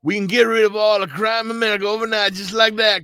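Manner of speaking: high, fast mocking imitation; mocking Ben Crump impression. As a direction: ham up a caricature of the lawyer, high and quick. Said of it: "let me say it in the proper accent".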